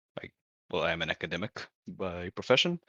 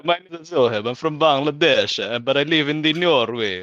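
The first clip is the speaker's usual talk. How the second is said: higher, louder, hammed-up bad Italian accent; terrible Italian accent; 6 semitones higher, 5 dB louder than usual. Player introducing himself at the table, in a terrible fake Italian accent. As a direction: put on a deliberately awful Italian accent.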